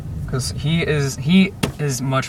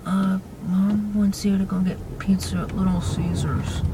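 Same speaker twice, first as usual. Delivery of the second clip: slow, whispery timid imitation; hushed, higher, hesitant voice; quietest voice, timid; 5 semitones higher, 4 dB quieter, slower than usual. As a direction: mimic a scared kid barely daring to speak. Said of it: "talks in the quietest voice".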